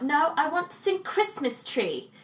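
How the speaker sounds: put-on high, slow childish demand; a small child insisting on her own song; insistent little girl. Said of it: "I was like".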